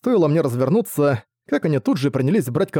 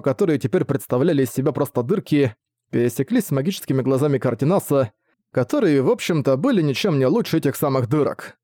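plain reading voice

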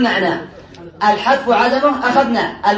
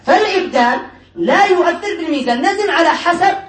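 usual speaking voice

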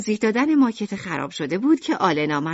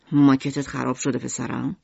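tender comfort; 6 semitones lower than usual. A narrator's reading. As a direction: soothe the boy gently. Father comforting son.

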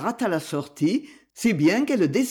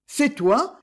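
voix mal assurée; 8 semitones higher than usual. A narrator's reading. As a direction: question hésitante, plus haute. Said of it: "d'une voix mal assurée". (Livia reconnaît son ancien amour, voix peu assurée)

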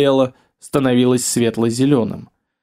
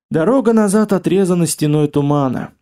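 ordinary delivery

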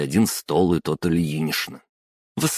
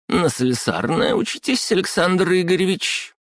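high squeaky official woman; 13 semitones higher than usual. Acted. Clerk questions him.

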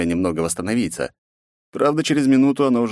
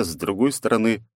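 usual speaking voice

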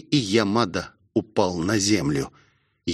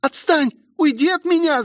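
очень высокий испуганный девичий крик; выкрикни высоко, отбиваясь от нападающей. A narrator's reading. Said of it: "закричала Роузи". (пронзительно кричать)

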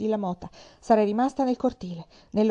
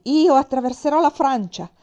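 loud, higher, defiant vow; furious declaration; 5 semitones higher, 10 dB louder than usual. Acted. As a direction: fling it at him in rage. (dichiarazione furiosa e forte, voce alta)

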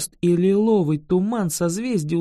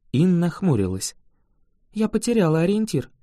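plain reading voice

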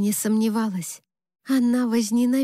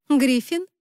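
higher, breezy answer; casual; 5 semitones higher than usual. A narrator's reading. Bride telling her friend who is there, casual. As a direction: toss the name off casually. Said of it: "непринуждённо ответила я".

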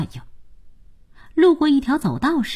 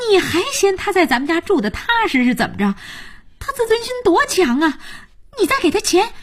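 high-pitched, agitated protest; getting worked up; 9 semitones higher than usual. A narrator's reading. Jiayin objects to her sister paying him, worked up. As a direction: argue urgently, pitch well up throughout. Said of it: "佳音急眼了".

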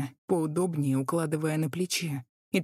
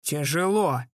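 грубо; 5 dB louder, slower than usual. A narrator's reading. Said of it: "грубо выругался".

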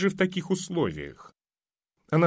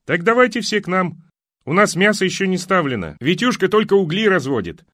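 calling out; 6 semitones higher, 5 dB louder than usual. Acted. Neighbour calling over the fence.

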